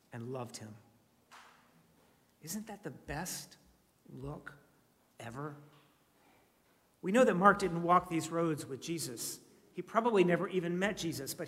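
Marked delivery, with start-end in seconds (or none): 0.1-5.5 tender hush. Real, not acted. Tender moment in text.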